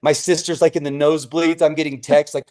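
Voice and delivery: normal talking voice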